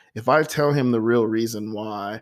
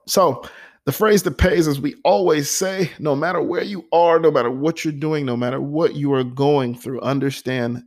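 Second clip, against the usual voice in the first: higher, bright sign-off pitch lifted for the slogan; upbeat catchphrase; 5 semitones higher than usual. Acted. Host hyping his viewers with his signature phrase, higher and upbeat.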